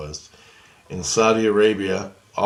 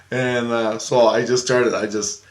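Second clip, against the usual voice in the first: ordinary delivery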